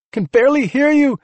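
high, loud yell over the din; strained-to-be-heard shout. A narrator's reading. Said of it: "she yelled".

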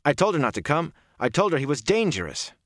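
high, upset insistence; agitated protest. Acted. Rand defends himself to two Aes Sedai, agitated.

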